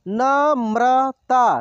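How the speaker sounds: Namrata drawn out slowly, syllable by syllable; slow sounding out. Shown as a demonstration. Teacher slowly sounds out Namrata for students. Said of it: "एक एक मात्रा को अलग अलग करके".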